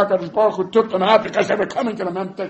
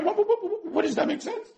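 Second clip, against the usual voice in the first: sputtering disbelief. Acted. Gideon sputtering that nothing makes sense, very high.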